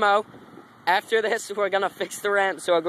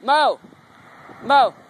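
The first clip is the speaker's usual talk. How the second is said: loud call across the yard; 5 semitones higher, 6 dB louder, slower than usual. Real, not acted. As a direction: call him back, loudly. Calling friend back from afar.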